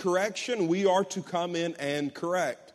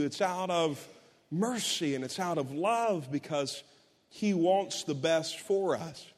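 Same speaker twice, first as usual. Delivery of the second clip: normal talking voice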